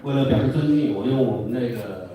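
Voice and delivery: usual speaking voice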